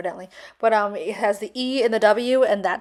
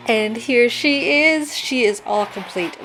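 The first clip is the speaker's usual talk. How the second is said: excited reveal; 2 semitones higher, 4 dB louder, slower than usual. Real, not acted. Revealing the finished ring.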